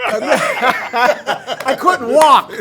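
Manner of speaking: loud, high laughing exclamation. Real, not acted. Guest recalls an old injury, laughing loudly. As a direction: burst out laughing mid-recollection, loud and high.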